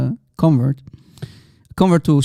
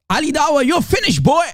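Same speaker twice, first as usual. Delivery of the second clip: high, mocking taunt; mock Hijab taunt; 9 semitones higher than usual. Mocking the debater, a high sneering taunt. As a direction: sneer it like a bully. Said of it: "Muhammad Hijab would have said".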